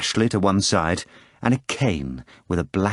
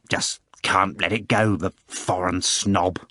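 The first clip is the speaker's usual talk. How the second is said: usual speaking voice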